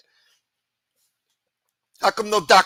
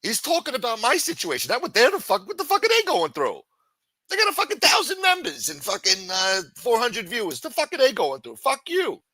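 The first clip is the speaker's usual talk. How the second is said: raised, higher indignant rant; venting at rivals in a raised voice; 8 semitones higher than usual. Real, not acted. Streamer ranting at rivals, raised and indignant.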